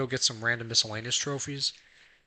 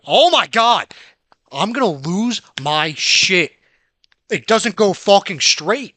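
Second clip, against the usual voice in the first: loud, slow, drawn-out angry yelling; about to lose it, spell out each word; furious yell; 5 semitones higher, 9 dB louder, slower than usual. Real, not acted. Gamer losing it at a character that won't go straight, slow loud yell. Raging at game controls.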